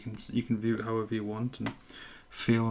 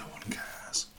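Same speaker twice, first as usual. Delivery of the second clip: whisper; 6 semitones higher, 8 dB quieter, much slower than usual. Real, not acted. Whispered aside.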